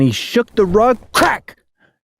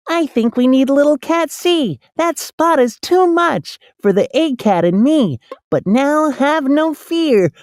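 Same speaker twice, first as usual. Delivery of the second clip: lightly voiced little cat, higher, cheery and sure; a small cat promising to clean up; 7 semitones higher than usual. A narrator's reading.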